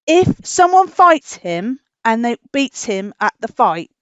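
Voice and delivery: ordinary delivery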